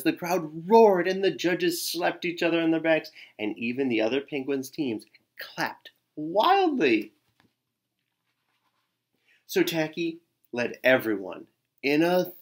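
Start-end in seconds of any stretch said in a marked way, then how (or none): none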